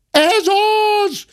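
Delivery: very high accusing shriek; maid's cry. A narrator's reading. Maid pointing at the gentleman, crying out her accusation. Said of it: "kiáltotta a szobalány".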